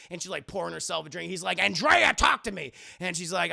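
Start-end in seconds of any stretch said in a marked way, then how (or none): 1.6-2.7 loud, higher exasperated call; acted insistent shout; urgent demand. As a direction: call her name sharply to get attention. Batman demanding she talk.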